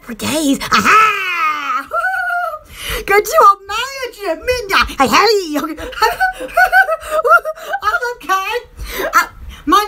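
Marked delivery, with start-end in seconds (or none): 1.9-2.6 short, high cackle; high laugh. Real, not acted. Laughing after "Aha!. 5.6-7.8 long, rising giggle; rising laugh. Real, not acted. Laughing along with the viewers.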